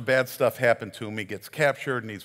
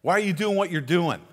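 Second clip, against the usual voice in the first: provoking call; 6 semitones higher, 8 dB louder than usual. Retold or imitated. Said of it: "Jesus is there saying".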